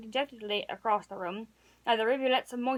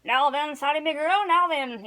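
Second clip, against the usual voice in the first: louder, a stern mock-scolding; father's wrath; 3 semitones higher, 6 dB louder than usual. A narrator's reading. Father scolding Sally for flirting, louder and stern. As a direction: scold her like a gruff but kindly dad. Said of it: "the full flood of her father's wrath".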